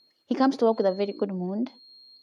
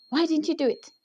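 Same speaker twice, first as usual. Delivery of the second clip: higher-pitched retold scolding; boss's tirade; 7 semitones higher than usual. Boss demanding answers from the intern, retold indignant scolding. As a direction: imitate the boss's indignant outburst. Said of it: "he started just throwing words".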